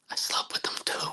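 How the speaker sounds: hushed whisper, no voice; whispered confession. Retold or imitated. Repeating her confession to himself in a whisper. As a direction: whisper it like a secret.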